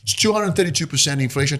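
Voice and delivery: normal talking voice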